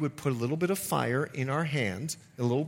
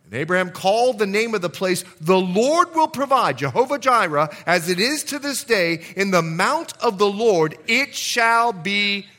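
preacher raising his voice to the church; preaching at full force; 7 semitones higher, 7 dB louder than usual. Acted. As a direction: build the fire of the sermon.

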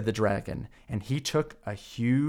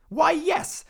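boastful dragon, pleased with himself; proud dragon. Acted. Dragon agrees eagerly to show off, high voice. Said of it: "said the dragon".

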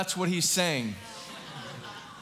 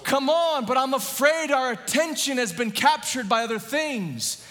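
fervent yelling sermon; preacher yelling; 4 semitones higher than usual. Real, not acted. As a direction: shout the good news at the church. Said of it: "Why is he yelling?".

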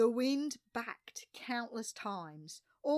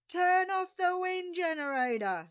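screamed order; 11 semitones higher, 9 dB louder than usual. A narrator's reading. Crewmate screaming to the narrator over the wind generator, frantic. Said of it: "screamed Noel".

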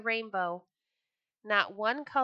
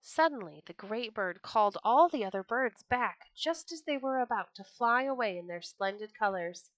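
ordinary delivery, nothing marked

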